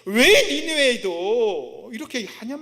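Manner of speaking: loud, high indignant harangue; acted outraged complaint; resentful protest. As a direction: argue Jonah's grievance at full volume, stretching the end. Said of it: "그게 싫은 거예요".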